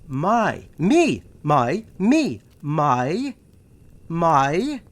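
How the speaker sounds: insistent repeated me, my; emphatic me, my. Real, not acted. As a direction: pound each my and me. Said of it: "We're talking about me here".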